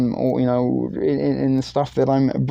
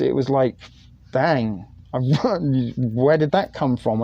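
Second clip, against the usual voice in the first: usual speaking voice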